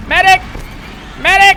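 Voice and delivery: loud high call for medic; man down shout. Real, not acted. Calling for help when hit.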